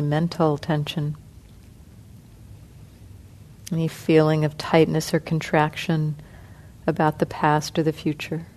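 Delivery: soft, unhurried meditation guidance with long pauses; guided meditation. Acted. Teacher guiding the sitting group through body relaxation, soft and calm. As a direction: ease the group gently through each part of the body.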